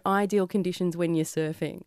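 ordinary talk

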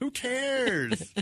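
high, breezy mock-kid dismissal; carefree kid. Dad mimicking button-pushing kids, high and carefree. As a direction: toss it off like a fearless child. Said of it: "They're like".

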